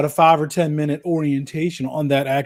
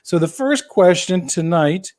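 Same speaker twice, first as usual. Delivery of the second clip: normal talking voice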